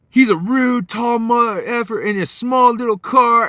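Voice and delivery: high, loud mock complaint; imitated indignant rant. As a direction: mimic a stranger loudly denouncing him to passers-by. Woman ranting to passers-by, retold.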